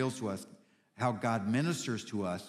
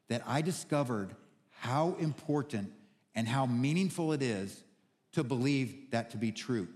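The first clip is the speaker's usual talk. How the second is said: ordinary talk, nothing marked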